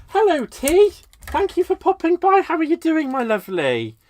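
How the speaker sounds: high, loud sing-out hello, easing down at the end; big high-pitched hello that settles on the last words; effusive greeting. Real, not acted. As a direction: call out a delighted welcome to a newcomer. Greeting a viewer who joined.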